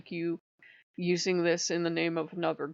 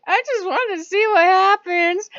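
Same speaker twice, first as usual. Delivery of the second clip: far higher, louder, faux-innocent squeal; innocent trickster Loki; 13 semitones higher, 12 dB louder than usual. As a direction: shrug it off like a prankster caught. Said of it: "Loki'd be like".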